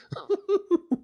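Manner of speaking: short high giggle breaking through; quick amused laugh; real laugh. Real, not acted. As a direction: laugh at the absurd letter. Reader laughs between letter lines.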